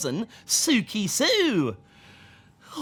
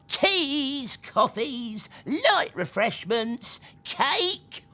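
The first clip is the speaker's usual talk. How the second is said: trolley call; 6 semitones higher, much slower than usual. Acted. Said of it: "said the voice".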